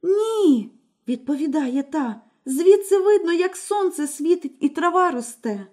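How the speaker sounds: put-on older sister calmly reporting. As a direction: answer simply from the tower.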